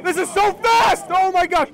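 high and loud with amazement; thrilled live-commentary yell; excited caster shout. Real, not acted. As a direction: burst out over the frantic action. Caster amazed by fast play.

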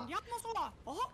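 high squeaky cartoon mouse; Mickey Mouse voice. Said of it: "Andiamo Topolino".

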